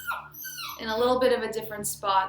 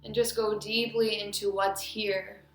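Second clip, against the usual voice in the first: guided meditation. Acted. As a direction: lead them inward, phrase by phrase with long pauses.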